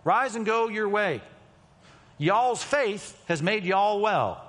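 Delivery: slow, drawn-out Southern "Y'all's faith has made y'all well"; a country Bible reading with "y'all" stretched out; mock Southern plural. Shown as a demonstration. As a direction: say "y'all" with a country drawl, slowly. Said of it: "here's how it would read".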